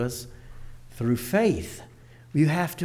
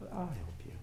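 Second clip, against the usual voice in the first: much softer, gentle teacher's promise; tender reassurance; 3 semitones lower, 16 dB quieter than usual. Retold or imitated. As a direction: softly comfort the crying child. Teacher comforts crying child.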